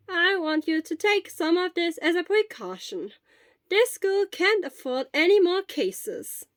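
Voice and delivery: put-on an old school nurse arranging things; Recovery Girl. A narrator's reading.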